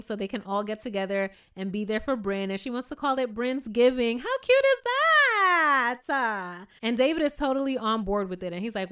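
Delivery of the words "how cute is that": gushing. Real, not acted. Gushing over Bryn's Giving.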